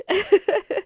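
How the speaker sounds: a real high burst of laughter; loud laugh. Real, not acted. Teacher laughing at her own grumbling about mowing. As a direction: laugh at yourself.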